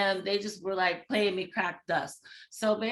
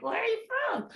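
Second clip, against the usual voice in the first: mock-surprised question pitched up high; curious surprise; 12 semitones higher than usual. On the phone asking her date where she is from, pitched up high. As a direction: ask it bright and high, intrigued.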